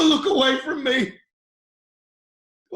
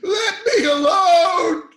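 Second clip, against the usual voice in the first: louder, slow, breaking cry; anguished outcry; 4 semitones higher, 6 dB louder than usual. Acted. Job begging God to leave him be, crying out. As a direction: plead loudly with tears in the voice.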